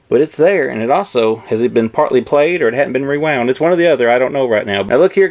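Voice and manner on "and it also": ordinary delivery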